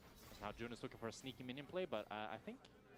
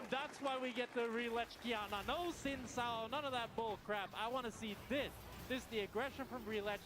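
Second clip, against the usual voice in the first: excited; 9 semitones higher than usual. Real, not acted. Caster hyping a double kill.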